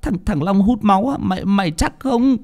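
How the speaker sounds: high, stammering question; startled and doubting, pitched well up; shocked disbelief. A narrator's reading. Bình hears Long drinks blood, shocked disbelief. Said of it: "nghe đến vậy thì cũng giật bắn cả người".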